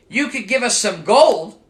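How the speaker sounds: lightly voiced Irish leprechaun shrugging it off; Irish accent. Leprechaun answers Michael in a faint Irish accent. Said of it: "I'm working on the Irish accent".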